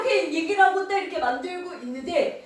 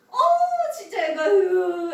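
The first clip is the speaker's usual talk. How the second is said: exasperated lament; 3 semitones higher, much slower than usual. Acted. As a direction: tut over those kids with an exaggerated sigh. Acted neighbours lamenting young couple.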